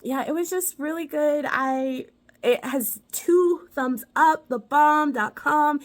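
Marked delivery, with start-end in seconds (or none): 2.4-5.8 drawn-out verdict. Real, not acted. Slow emphatic verdict.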